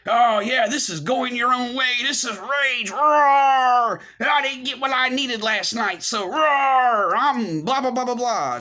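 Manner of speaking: high, loud hammed-up raging with big roars; mock rage. Mocking men who sell rage, hammed-up roaring. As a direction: roar it out like a cartoon tough guy. Mocks others' posturing as rage.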